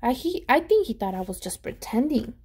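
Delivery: ordinary delivery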